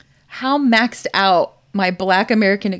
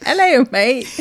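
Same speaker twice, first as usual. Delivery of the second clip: a hint of a kid's bad Australian accent, a bit louder; lightly mock the Australian accent like a child; barely-there fake Australian "Hello, mate"; 5 semitones higher, 5 dB louder than usual. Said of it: "really bad Australian accent".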